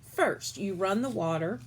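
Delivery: usual speaking voice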